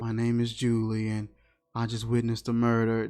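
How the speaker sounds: soft, low, deadpan report of a murder; flat calm Julie. Retold or imitated. Mocking Julie's deadpan phone call about a murder, soft. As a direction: say it like nothing happened. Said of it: "That's how she was talking".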